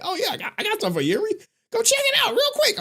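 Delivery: high, excitable imitation of a viewer; hammed-up high-pitched urging; mock eager troll. Mimics his trolling viewers.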